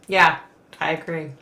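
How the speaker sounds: ordinary talk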